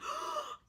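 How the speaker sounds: sharp fangirl gasp; starstruck gasp. Retold or imitated. Seeing James Saint James walk by, starstruck gasp. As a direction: gasp as if freaking out over an idol. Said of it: "freaking out".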